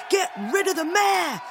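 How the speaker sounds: high, insistent mob chant; crowd shouting. A narrator's reading. Rival townspeople turning on the mayor, shouting. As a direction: cry out in protest, pitched right up. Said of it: "others were shouting".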